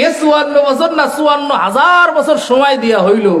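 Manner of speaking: high, forceful oratory with stretched key words; impassioned preaching. Real, not acted. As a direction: thunder it out to the crowd in rising waves. (জোরালো চড়া গলায় ওয়াজ)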